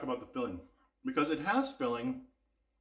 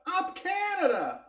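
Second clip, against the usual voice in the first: cheering; 5 dB louder, slower than usual. Pretend. Patriotic cheer for Canada.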